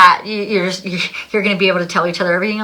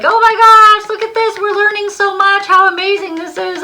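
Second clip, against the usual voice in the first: much higher, much louder gleeful cheer; cheering excitedly; 13 semitones higher, 7 dB louder than usual. Retold or imitated. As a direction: cheer someone on like a thrilled fan. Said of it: "sometimes your higher self is there cheering you on".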